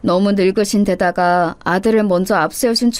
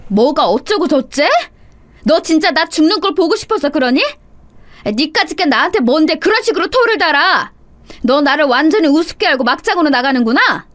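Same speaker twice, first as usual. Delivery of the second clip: high-pitched outraged mother-in-law; furious yelling; 6 semitones higher than usual. A narrator's reading. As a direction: rail at the daughter-in-law, voice pushed up high. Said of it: "제가 악을 쓰면서 몸을 떨었습니다".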